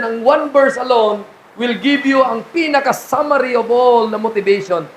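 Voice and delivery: ordinary talk, nothing marked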